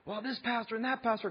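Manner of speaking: very high-pitched mock berating; imitated livid ranting. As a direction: rant like the livid dentist. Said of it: "he is just livid".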